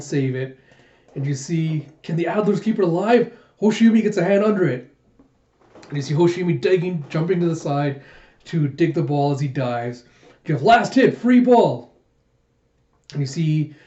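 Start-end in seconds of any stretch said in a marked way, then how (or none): 2.0-4.9 raised, quickening play-by-play; excited live match call; sports commentator. Acted. 10.6-11.9 high, loud player's shout; raised, urgent match call; court call. Acted.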